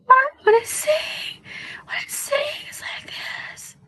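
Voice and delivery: very high, slow, fading into a whisper; fried high voice. Shown as a demonstration. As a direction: squeeze it up until it cracks away. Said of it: "my voice is fried there".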